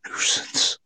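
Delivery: breathy, unvoiced whisper of "Nuisance"; imitating a spirit's whisper on the recording; ghostly whisper. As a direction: whisper "Nuisance" like a faint disembodied voice. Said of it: "It was like".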